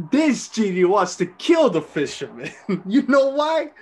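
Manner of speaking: words broken by real laughter; laughing through it. Real, not acted. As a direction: giggle your way into the joke. Actor laughing through his line.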